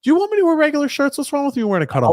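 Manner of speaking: shoots way up high and fast in fake indignation; mock outrage. Defending his cutoff shirts to his co-host, high mock outrage. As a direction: squeak back in mock offense, quick.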